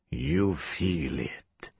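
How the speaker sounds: quiet, low, slow menace; low menacing villain. Acted. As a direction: savor the cruelty softly. Villain savors victim's pain.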